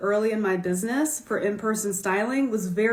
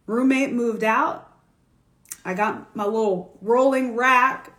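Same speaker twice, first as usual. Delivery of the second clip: ordinary delivery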